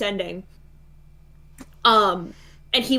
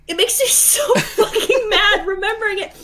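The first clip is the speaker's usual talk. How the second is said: laughing while angry; 8 semitones higher than usual. Real, not acted. Laughing while recalling anger.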